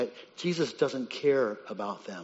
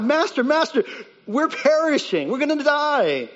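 high, loud, frantic plea; desperate cry; 13 semitones higher, 6 dB louder than usual. Acted. Disciples waking Jesus in the storm, desperate cry. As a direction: cry out as if drowning. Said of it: "and they cry out".

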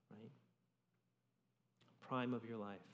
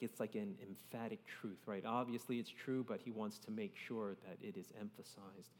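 ordinary talk, nothing marked

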